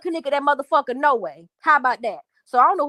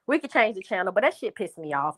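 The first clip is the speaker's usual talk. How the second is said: ordinary talk, nothing marked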